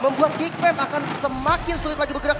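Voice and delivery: excited commentary. Acted. Co-caster hyped after fight.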